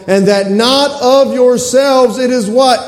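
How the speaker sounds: preacher's leading call; rising call-out. Real, not acted. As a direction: pull the answer out of the crowd. Preacher cues congregation's answer.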